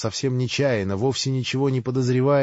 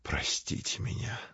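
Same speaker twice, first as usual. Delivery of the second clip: whispered, almost moaning; moaned whisper; 12 dB quieter than usual. Acted. Katya whispers an apology to Grushenka. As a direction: beg forgiveness in a whisper. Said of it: "тихо, почти шёпотом простонала ей".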